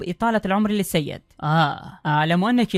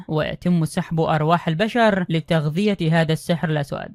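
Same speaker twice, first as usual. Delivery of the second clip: ordinary delivery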